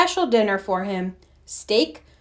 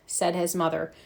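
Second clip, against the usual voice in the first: usual speaking voice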